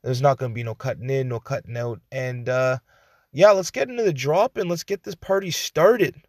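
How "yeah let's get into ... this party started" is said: high, loud party hype; hype announcer. Acted. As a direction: pump up the crowd. Hype line over music.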